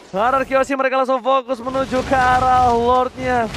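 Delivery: usual speaking voice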